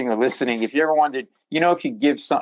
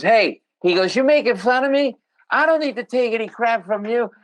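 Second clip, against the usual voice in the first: bloodied guy confronting; 11 semitones higher than usual. Retold or imitated. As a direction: challenge the comic like a hurt, angry drunk.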